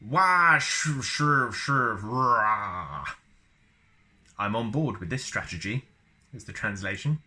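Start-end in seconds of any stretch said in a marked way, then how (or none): 0.1-3.0 Wookiee roar. Shown as a demonstration.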